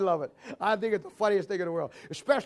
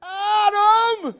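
high, loud calling out with a laugh; 10 semitones higher, 5 dB louder than usual. Retold or imitated. God hollering for Adam in the garden, loud call. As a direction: holler the name across the garden.

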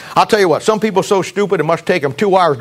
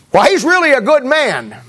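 mimicked pastor; 8 semitones higher than usual. Preacher mimics pastor's praise.

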